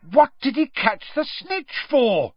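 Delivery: high, indignant shouted question; bellowing. A narrator's reading. Ron baffled by the catch, bellowing over cheers. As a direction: bellow it in disbelief. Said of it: "Ron bellowed".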